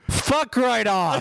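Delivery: high, slow mock British accent; exaggerated British send-up. Shown as a demonstration. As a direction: draw it out in hammed-up British. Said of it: "do it with more British accent".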